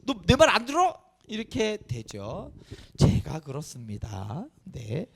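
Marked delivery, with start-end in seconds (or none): 0.0-0.9 raised, louder parental scolding; mock angry parent. Parent losing temper at a child, mock angry scolding. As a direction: scold the child, acted and brief. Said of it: "승질내고 화내면".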